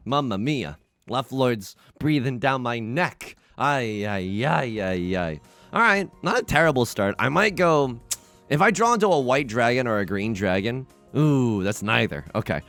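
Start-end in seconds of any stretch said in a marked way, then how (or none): none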